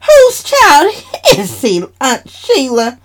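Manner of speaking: high laughing tease. Acted. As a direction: giggle through the question. Eva laughing, teasing.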